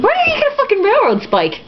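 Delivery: high, disbelieving outburst; incredulous. Real, not acted. Reader interrupting the book, incredulous. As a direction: throw it out in disbelief. Outraged aside mid-reading.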